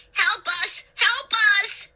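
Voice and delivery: high, panicked shrieks; frantic high-pitched plea; shrieking. A narrator's reading. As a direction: shriek for rescue, rising in pitch. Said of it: "Clay started to shriek".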